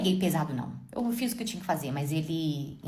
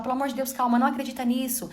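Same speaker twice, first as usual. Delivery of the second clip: pleading husband imitation; 6 semitones higher than usual. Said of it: "Ele falava".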